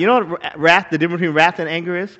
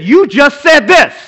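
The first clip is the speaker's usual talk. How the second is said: Judge Judy verdict; 7 semitones higher, 11 dB louder, slower than usual. Retold or imitated. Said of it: "And then after she said".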